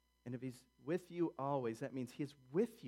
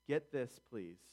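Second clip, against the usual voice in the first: normal talking voice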